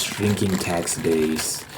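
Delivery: normal talking voice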